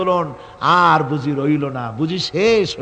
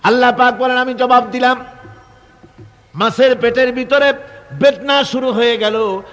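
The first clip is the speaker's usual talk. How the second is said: high, raised declamation in separated phrases; impassioned preaching; 9 semitones higher, 5 dB louder than usual. Real, not acted. Preacher tells crowd of Yunus and the fish, impassioned preaching. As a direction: proclaim it to the crowd, pausing between phrases.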